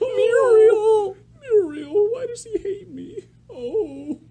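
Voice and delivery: high whiny cartoon old man; Eustace voice. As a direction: whine like a grumpy cartoon farmer. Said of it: "That's Eustace with my old man voice".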